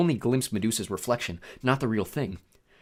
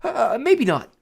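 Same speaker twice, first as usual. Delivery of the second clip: high, sheepish alarm; high-pitched worried backtrack; nervous correction; 12 semitones higher, 5 dB louder, much slower than usual. A narrator's reading. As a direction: take it back nervously. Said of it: "Grover corrected".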